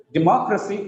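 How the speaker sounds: "Democracy" with a clear beat on "moc"; stress on MOC. Shown as a demonstration. Teacher correcting a participant's reading, "Democracy" stressed on "moc". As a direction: make the second syllable of "Democracy" stand out. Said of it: "if you give stress on more".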